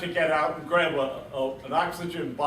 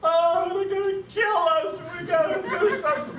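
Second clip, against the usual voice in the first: much higher, louder, fast panicky cry; panicked scream; 13 semitones higher, 3 dB louder than usual. Retold or imitated. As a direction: squeal it like a terrified officer under fire. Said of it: "He starts screaming over the intercom".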